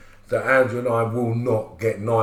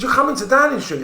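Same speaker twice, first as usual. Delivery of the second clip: much higher, louder, pressing insistence; insisting friend; 13 semitones higher, 5 dB louder than usual. Retold or imitated. Friend on the phone insisting it is no joke, excited. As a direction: convince him it's true.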